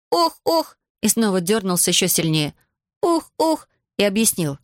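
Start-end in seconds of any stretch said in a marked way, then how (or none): 0.1-0.8 high acted «ох, ох»; jerky, high-pitched cries of distress; yelping groan. A narrator's reading. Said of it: "вскрикнул Иван и дёрнулся". 3.0-3.7 high acted «ох, ох»; jerky, high-pitched cries of distress; yelping groan. A narrator's reading. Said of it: "вскрикнул Иван и дёрнулся".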